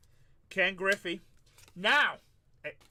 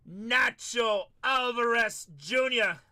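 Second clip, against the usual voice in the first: excited slow shout; 3 semitones higher, 3 dB louder, slower than usual. Real, not acted. Hyped card pull reveal.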